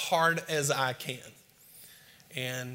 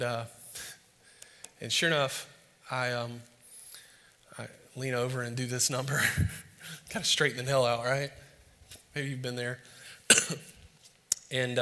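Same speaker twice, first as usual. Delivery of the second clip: usual speaking voice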